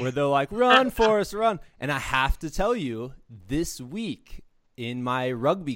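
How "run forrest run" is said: high, loud taunting chant of the catchphrase; loud playground taunt; mocking kids' shout. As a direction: yell it like a kid teasing a runner. Kids mocking him with the catchphrase.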